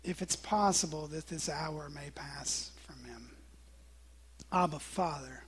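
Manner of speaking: low, soft and slowed, with pauses; hushed prayer. Retold or imitated. Jesus praying to his Father in Gethsemane, hushed and low. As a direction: pray quietly, imitating a troubled plea. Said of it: "and he prays".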